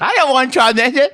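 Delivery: put-on high, loud, indignant old lady; grandma voice. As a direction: refuse firmly as the grandmother. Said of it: "and she's like".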